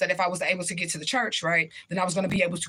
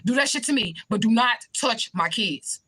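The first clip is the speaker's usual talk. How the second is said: higher, pushed defiance, slowing at the end; defiant; 4 semitones higher than usual. Retold or imitated. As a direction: stand between him and the kids. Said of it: "I was like".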